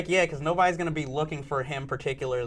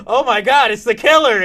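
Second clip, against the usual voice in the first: high, loud hammed-up alarm; mock panic; 7 semitones higher, 10 dB louder than usual. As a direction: cry out like a startled horror victim. Acting out janitor finding the killer.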